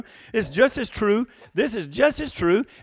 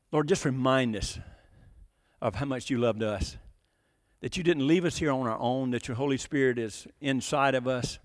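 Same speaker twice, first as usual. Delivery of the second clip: low, soft prayer with long pauses; 5 semitones lower, 4 dB quieter than usual. Real, not acted. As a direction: speak humbly to God, pausing between phrases. Leads the congregation in prayer.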